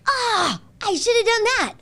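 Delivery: high, sharp self-reproach; regretful outburst. Retold or imitated. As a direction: kick yourself out loud. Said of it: "have this thought like".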